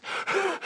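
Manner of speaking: hammed-up breathless heaving; winded gasping. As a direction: gasp for air like an exhausted runner. Exhausted runner out of breath.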